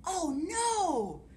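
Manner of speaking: "Oh no!" in a big, high, loud wail; dramatic oh no. Shown as a demonstration. Teacher demonstrating the story refrain, dramatic high wail. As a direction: throw "Oh no!" out like real dismay. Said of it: "you can do this".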